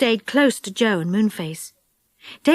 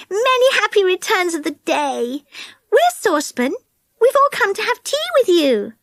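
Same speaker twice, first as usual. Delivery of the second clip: put-on higher, eager young girl; Connie's bright girl voice; 9 semitones higher than usual. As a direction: speak up high like a keen little girl. Connie greets old woman.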